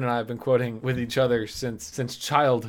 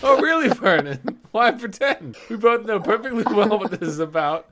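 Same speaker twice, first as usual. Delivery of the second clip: higher, louder, clipped accusing voice; arguing announcer; 6 semitones higher, 4 dB louder than usual. Retold or imitated. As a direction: throw it at him like a fed-up wife. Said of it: "The announcers are just arguing".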